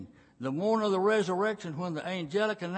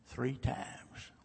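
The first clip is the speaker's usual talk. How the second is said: hushed, breathy whisper of Jesus' warning; 3 semitones lower, 7 dB quieter than usual. Acted. Jesus warns Peter in a hushed whisper. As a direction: drop to a whisper on the prophecy.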